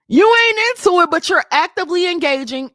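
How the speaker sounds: loud mocking. Real, not acted. Streamer mocking viewers' excuse, loud and high. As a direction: throw their excuse back at them.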